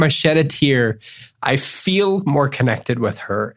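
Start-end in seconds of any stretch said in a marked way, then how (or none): none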